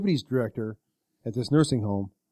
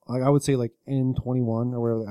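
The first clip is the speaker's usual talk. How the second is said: ordinary talk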